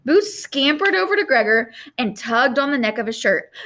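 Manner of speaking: ordinary delivery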